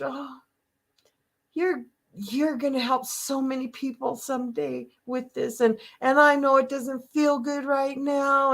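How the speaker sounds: high, sugary churchgoer voice; sweet church folk. Retold or imitated. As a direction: mimic kindly church ladies comforting her. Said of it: "people would tell me in church".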